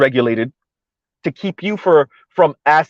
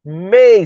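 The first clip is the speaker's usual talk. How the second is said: "may" drawn out and punched louder; stress on may; 5 semitones higher, 3 dB louder than usual. Acted. Elite offering the leftovers, stress on "may". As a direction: lean hard and long on "may". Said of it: "emphasis on may".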